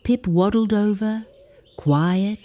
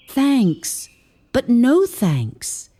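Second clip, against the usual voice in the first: higher, slow stubborn grumble, leaning on no; grumbling; 5 semitones higher, much slower than usual. A narrator's reading. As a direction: refuse it with a proud little pout. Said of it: "he'd grumble".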